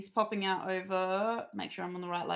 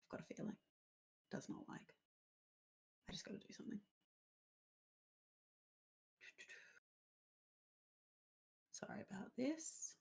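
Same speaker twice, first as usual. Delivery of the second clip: whispering; 2 semitones higher, 19 dB quieter, faster than usual. Real, not acted. Muttering while fixing stream.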